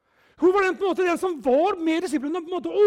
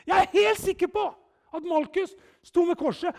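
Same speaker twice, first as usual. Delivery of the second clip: normal talking voice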